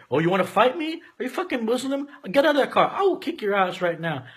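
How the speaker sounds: high, aggressive mock threat; retold drunk bully challenging him; belligerent drunk. Drunk belligerent soldier picking fight.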